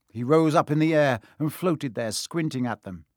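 ordinary delivery

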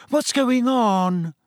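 high, slow, drawn-out question; wondering girl's voice. A narrator's reading. Newcomer Ginny asks amid commotion.

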